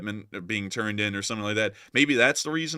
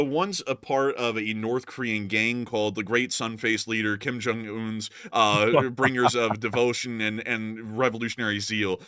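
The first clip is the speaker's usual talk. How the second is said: ordinary delivery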